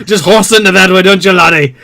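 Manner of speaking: loud, hammed-up Scottish accent; a fake Scottish accent shouting a game pitch; awkward Scottish accent. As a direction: play it as a pushy Scottish salesman. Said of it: "a very awkward Scottish guy".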